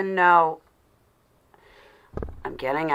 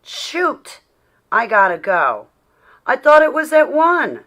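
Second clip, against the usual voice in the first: a high, sudden "shoot" and louder, startled realization; alarmed exclamation; 8 semitones higher, 9 dB louder than usual. Real, not acted. Host realizing on stream she missed Bible study, startled and loud. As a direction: suddenly realize you're late and blurt it out.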